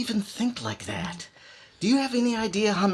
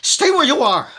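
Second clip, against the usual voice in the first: high, loud command at gunpoint; shouted order; 9 semitones higher, 9 dB louder than usual. Acted. Killer shouts at blackmailer to stay put, loud and high. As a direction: bark it to freeze him.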